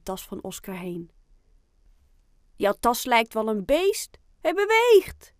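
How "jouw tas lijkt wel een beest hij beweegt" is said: loud, building to a high cry; excited boy calling out. A narrator's reading. Boy to classmates about the moving bag, excited shout. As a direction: call out your discovery, rising higher and louder. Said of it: "roept een jongetje met een bril".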